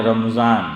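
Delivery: "رمضان" as ram-zaan, no vowel after the meem; common mispronunciation. Shown as a demonstration. Preacher showing how our people say it, everyday mispronunciation. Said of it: "ہم لوگ اس کو بولتے ہیں".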